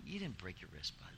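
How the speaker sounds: low, very soft dismissal; dismissive dad. Retold or imitated. Dad to his hurt son on the phone, quietly dismissive.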